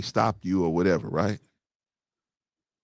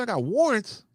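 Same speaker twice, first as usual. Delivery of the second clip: incredulous; 9 semitones higher than usual. Retold or imitated. Retold disbelief at cop's claim.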